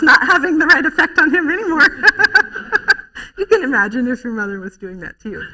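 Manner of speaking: loud, real laughter breaking into the words; laughing while talking. Real, not acted. Speaker amused at her own mothering story, laughing. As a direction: laugh through the telling.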